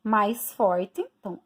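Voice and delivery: normal talking voice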